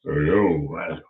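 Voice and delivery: low, fading hey yo; low bum voice. Retold or imitated. The bum in the building calling out, low and fading. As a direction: drawl it like a lurking bum. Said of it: "I heard someone say".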